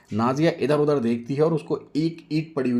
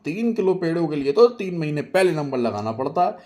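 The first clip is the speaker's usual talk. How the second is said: lightly voiced shopkeeper; 7 semitones higher, 4 dB louder than usual. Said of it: "Pappu भाई बोले".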